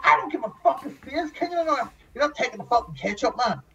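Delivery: high, fast mocking imitation; fast, high imitation of a rude man; mock gruff refusal. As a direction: rattle off the refusal in a squeaky voice.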